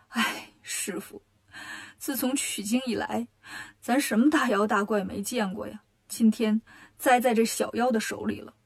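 low, soft tearful lament; acted crying. A narrator's reading. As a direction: lament to your absent master through mock sobs. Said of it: "忍不住哭了". (低声哭诉)

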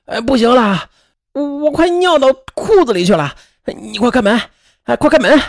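urgent, high-pitched begging; desperate put-on plea; pleading cry. A narrator's reading. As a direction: beg as if you cannot hold it any longer. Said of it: "大声地对张丽红央求道".

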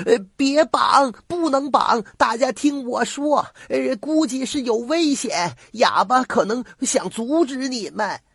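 very high, urgent grandmother's cry; high-pitched pleading shout across the way; shouting from afar. A narrator's reading. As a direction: call out from a distance to stop them. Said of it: "远远地喊道".

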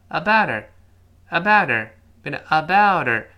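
ABOUT HER as "abouder", T softened to D; voiced T linking. Shown as a demonstration. Teacher showing the very fast form, linked. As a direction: drop the H, voice the T. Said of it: "所以读得非常快".

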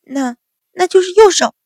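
high, loud flustered stammer. A narrator's reading. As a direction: stumble over it, losing nerve. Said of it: "结结巴巴道".

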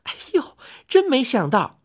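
exclaiming in surprise. A narrator's reading. Chang'e hears she is famous, exclaiming in surprise. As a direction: cry out, caught off guard. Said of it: "嫦娥叫了起来".

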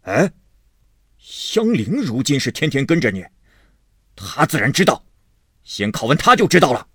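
loud, fast, gruff urging; hasty husband. A narrator's reading. Husband insisting to his wife, loud and hurried. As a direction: rush to blame the maid. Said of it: "薛蟠道".

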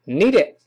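"needed" as one quick "nee-dit"; short, linked "needed"; linked reading. Shown as a demonstration. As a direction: join "need it" into one word, t held back. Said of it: "连读变成".

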